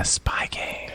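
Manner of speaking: hushed trailer whisper. Pretend. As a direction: whisper the title with awe. Whispered repeat of the title.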